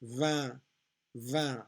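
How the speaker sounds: "Vin, vin" said identically, nasal vowel; French "vin", nasal and separate; identical pronunciation. Shown as a demonstration. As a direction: repeat French "vin" with no final N. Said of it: "pronounced exactly the same".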